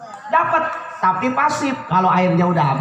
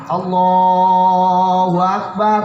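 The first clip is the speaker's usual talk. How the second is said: prayer call; 3 semitones lower, 3 dB louder, much slower than usual. Retold or imitated. Imitating the son praying.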